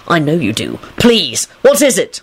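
urgent plea rising at the end; desperate. A narrator's reading. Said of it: "Lupin said desperately".

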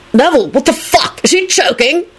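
high-pitched startled cry; startled, urgent question; alarmed outburst. A narrator's reading. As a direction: blurt it out in alarm. Alarmed reaction to choking.

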